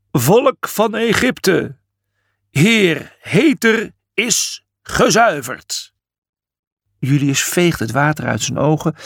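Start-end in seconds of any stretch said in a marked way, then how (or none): 0.1-6.0 high, very slow ceremonial announcement; solemn proclamation. A narrator's reading. Priest addressing the people of Egypte, proclaiming. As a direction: proclaim it word by word.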